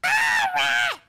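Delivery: startled shriek. Real, not acted. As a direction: yelp at the rat. Brother startled by a rat.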